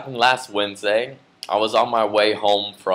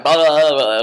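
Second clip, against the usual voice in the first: yelling; 5 semitones higher, 5 dB louder than usual. Retold or imitated. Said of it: "I start yelling at him".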